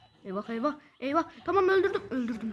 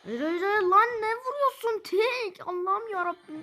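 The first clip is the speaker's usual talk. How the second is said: frustrated outburst; 5 semitones higher, slower than usual. Real, not acted. Teammate hits wrong target.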